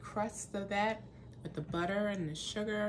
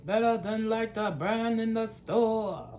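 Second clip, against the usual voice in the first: loud teasing robot voice; mock her stiff robot delivery; 6 dB louder than usual. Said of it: "Why you gotta talk like a robot?".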